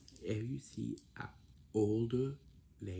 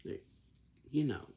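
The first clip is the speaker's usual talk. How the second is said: curt reply; 5 semitones higher, 5 dB louder than usual. Shown as a demonstration. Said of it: "You say".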